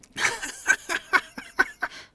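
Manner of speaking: loud, high bitter laugh. Acted. Shadowheart answers the question with a loud bitter laugh. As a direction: burst out in a sharp mirthless laugh.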